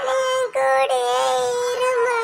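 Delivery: put-on high tearful child pleading; crying little girl voice. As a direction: beg mother not to leave, sobbing. Child crying as mother leaves.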